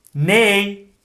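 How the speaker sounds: acted cross refusal; emphatic refusal. A narrator's reading. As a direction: repeat it firmly for someone who didn't hear. Grumpy character repeats refusal louder.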